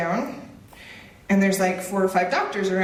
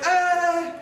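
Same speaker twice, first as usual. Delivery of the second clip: stunned uh; 9 semitones higher than usual. Retold or imitated. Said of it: "And I'm like".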